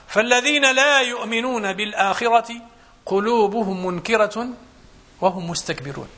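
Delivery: slow, measured chant with long held words; slow Quranic recitation. Acted. Preacher quotes the verse to his congregation, slowly intoned. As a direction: recite the verse, drawing each word out. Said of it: "قال الله سبحانه وتعالى".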